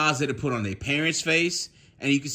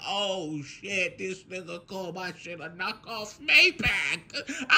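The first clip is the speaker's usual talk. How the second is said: reenacted helpless laughing fit; gut laughing; 3 semitones higher, slower than usual. Retelling being called out over the car, gut laughing. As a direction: struggle the words out, dragging into a laugh. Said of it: "bent over holding my shit like".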